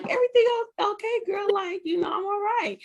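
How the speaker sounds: lightly hammed-up high reply; mock high voice. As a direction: answer the child in a sing-song high tone. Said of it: "And I'm like".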